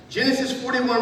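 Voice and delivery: ordinary talk, nothing marked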